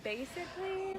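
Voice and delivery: teasing. Retold or imitated. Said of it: "Why did I say basically".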